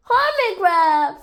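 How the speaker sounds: high, loud call falling at the end; joyful exclamation. A narrator's reading. Rabbit greeting the found friend, loud high exclamation. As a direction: cry out the friend's name.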